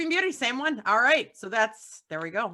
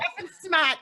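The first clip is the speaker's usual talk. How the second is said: Boston accent; 8 semitones higher than usual. Real, not acted. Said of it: "Correcting the Boston girl on her accent".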